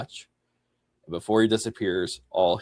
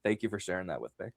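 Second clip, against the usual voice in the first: soft thanks; 2 semitones lower, 10 dB quieter, faster than usual. Retold or imitated. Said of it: "he's like".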